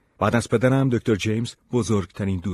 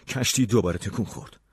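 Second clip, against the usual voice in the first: usual speaking voice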